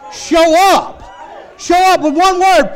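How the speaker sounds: high, loud preacher's shout; shouted pulpit rallying cry; full-force preaching. Real, not acted. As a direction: urge the congregation at full volume.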